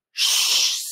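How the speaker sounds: long, breath-only "Shh"; sh sound demo. Shown as a demonstration. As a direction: model "Shh" as one held hiss. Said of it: "I want you to say".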